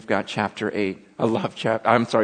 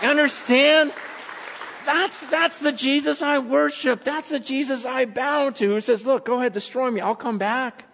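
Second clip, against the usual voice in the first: emphatic, raised-pitch proclamation; impassioned preaching; 10 semitones higher, 4 dB louder than usual. Real, not acted. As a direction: testify with full conviction. Preaches at full force.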